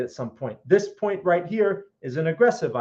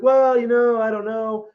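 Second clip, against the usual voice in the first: unsure trader; 6 semitones higher, 5 dB louder than usual. Pretend.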